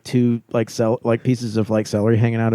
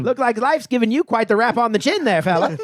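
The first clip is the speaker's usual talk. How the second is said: put-on mid-Atlantic accent; 9 semitones higher than usual. Old-timey hotel ad bit.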